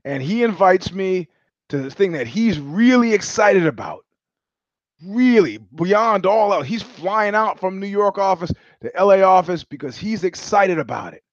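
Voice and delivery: ordinary talk, nothing marked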